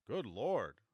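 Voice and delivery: loud, startled exclamation; surprised. Acted. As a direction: burst out in surprise. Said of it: "said Henderson".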